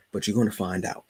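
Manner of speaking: ordinary talk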